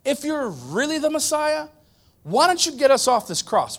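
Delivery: a lightly voiced taunting thief, high; mocking thief. A preacher voicing the thief on the cross, taunting lightly. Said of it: "One thief says to Jesus".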